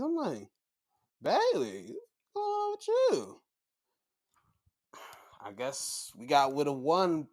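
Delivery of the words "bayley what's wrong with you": bewildered. Retold or imitated. Acting bewildered reaction to meltdown.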